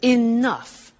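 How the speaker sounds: "enough" said clearly as ih-NUFF with a falling tune; model pronunciation. Shown as a demonstration. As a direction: say "enough" slowly, stress on NUFF. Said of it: "The pronunciation is".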